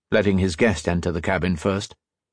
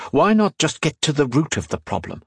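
higher-pitched chiding of oneself; raised pitch, impatient with yourself; self-scolding; 6 semitones higher than usual. A narrator's reading. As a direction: give yourself a brisk telling-off. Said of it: "he scolded himself".

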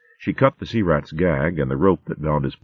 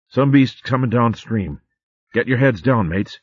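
urgent otter warning; 7 semitones higher than usual. Acted. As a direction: hiss a warning to your mates. Otter warns of approaching beast.

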